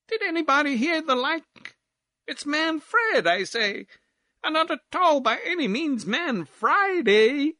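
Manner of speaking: high, indignant lady; bawled argument. Acted. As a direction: argue the point at the top of the voice. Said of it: "here bawled out Miss Barbleu".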